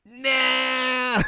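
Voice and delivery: high shouted nah; dismissive nah. Retold or imitated. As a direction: yell a mocking nah. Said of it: "we're like".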